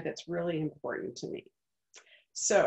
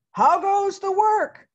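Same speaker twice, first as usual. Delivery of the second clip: higher, louder, slow farmer's call; 13 semitones higher, 10 dB louder, slower than usual. Acted. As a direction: call out lazily from bed. Farmer calls again.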